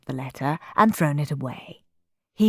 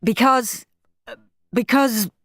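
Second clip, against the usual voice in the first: a hint of a stammering father; Dad; 5 semitones higher than usual. A narrator's reading. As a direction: stumble over it. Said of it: "said Dad".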